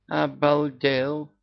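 slow, high-pitched astonished exclamation; shocked gasp. A narrator's reading. Yaga stunned by the cat's announcement, gasping. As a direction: stretch it out in shock. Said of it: "ахнула Яга".

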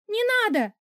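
тонкий испуганный писк, намного выше; пискнуть в страхе. Acted. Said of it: "Мой писк". (героиня пищит великану, тонко и испуганно)

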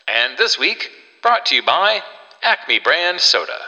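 bright sponsor intro; chipper 1950s ad. Acted. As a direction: sell it like a radio sponsor. Host segues into sponsor read.

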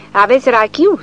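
a startled clerk's query; surprised. A narrator's reading. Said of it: "se miră funcționarul".

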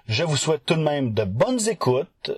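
ordinary delivery

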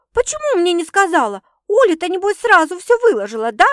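high, loud indignant accusations; yelling. A narrator's reading. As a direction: scold at full voice, jealous of Оля. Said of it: "заорала сестра". (громко, возмущённо, на высоких нотах)

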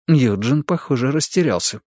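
usual speaking voice

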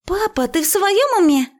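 put-on high-pitched girlish indignation; outraged daughter. A narrator's reading. Daughter challenges father from the cellar, shrill outrage. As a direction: protest far up in pitch.

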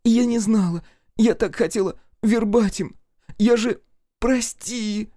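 very high, tearful pleading; wailing plea. A narrator's reading. Kira clings to narrator's knees, wailing apology. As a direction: beg and wail, pitched far up. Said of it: "Кира вдруг завыла, поползла".

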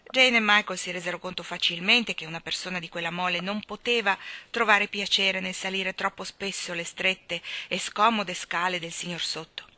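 normal talking voice

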